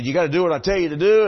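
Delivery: fast, loud commanding order; hammed-up Balaam bossing the donkey; bossy Balaam. Preacher acting Balaam ordering the donkey, loud and bossy. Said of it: "I became Balaam".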